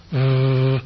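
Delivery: v as a sustained buzzing sound; v drawn out as a pure sound, no letter name; voiced fricative. Shown as a demonstration. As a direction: hold a voiced vvv with the vocal cords vibrating.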